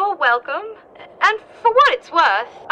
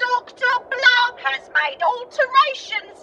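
higher-pitched computer voice in Cockney; Cockney accent; 6 semitones higher than usual. Acted. Computer explains its altered speech to the doctor, in Cockney. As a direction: report evenly as a Cockney computer. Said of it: "why do you sound Cockney".

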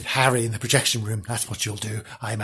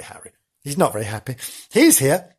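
ordinary talk

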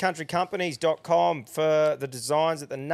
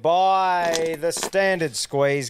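announcer voice; 4 semitones higher, much slower than usual. Acted. Sponsor read on podcast.

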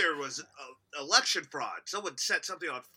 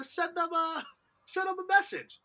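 high comic voice; 12 semitones higher than usual. Pretend. Joking instruction.

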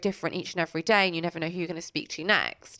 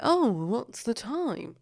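slow, higher, barely acted puppet line; unemotive puppet voice; 4 semitones higher, much slower than usual. Imitating Mop a Top's voice actor, slow unemotive delivery. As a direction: imitate him without any emoting, drawn out. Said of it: "he doesn't emote".

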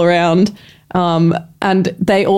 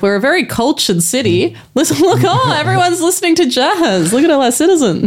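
lightly voiced show-off host, higher pitch; mock civic pride, climbing higher; boastful official; 7 semitones higher than usual. Government showing a visitor the cultured city, mock-proud higher voice.

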